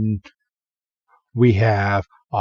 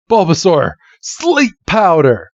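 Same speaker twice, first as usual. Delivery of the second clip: called command; 6 semitones higher, 3 dB louder than usual. Retold or imitated. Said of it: "And Red's like".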